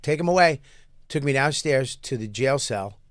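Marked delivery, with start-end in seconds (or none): 0.0-0.6 a higher, louder curt command; mock judge order. Teller voices the judge sending him to jail loudly. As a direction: bark it like a judge ending the hearing.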